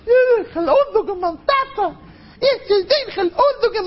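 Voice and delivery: put-on very high, squealing small-child voice; boasting little child. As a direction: pipe up like an excited kid bragging about Daddy. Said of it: "Hörst du mal, wie ein Kind sagt". (א הויך קינדעריש קול, באַרימט זיך מיטן טאַטן)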